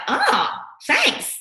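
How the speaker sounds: high, bright mock client thanks; grateful client. Client gushing thanks to coach, high and sweet. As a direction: squeal thanks like a delighted client.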